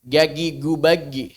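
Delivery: Gagi gubagi with hard g sounds, slow; Egyptian g for jim. Shown as a demonstration. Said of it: "Nanti jadi G".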